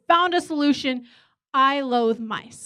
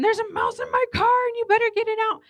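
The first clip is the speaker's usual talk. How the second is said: calling husband; 10 semitones higher than usual. Retold or imitated. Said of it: "I'm calling my husband going".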